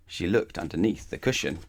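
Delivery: usual speaking voice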